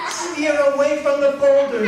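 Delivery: high, loud yell of alarm; alarmed father hollering; urgent warning shout. Acted. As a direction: shout to steer a child clear of danger.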